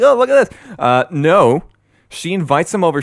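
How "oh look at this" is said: high, loud, bright mock-delighted cry; mock ad excitement. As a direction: sell the clean clothes like a gushing ad. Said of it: "and be like".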